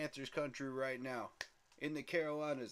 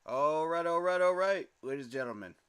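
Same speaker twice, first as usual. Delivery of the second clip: hype shout; 2 semitones higher, 9 dB louder than usual. Real, not acted. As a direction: fire up the crowd. Hyped stream opener shout.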